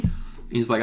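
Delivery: ordinary talk, nothing marked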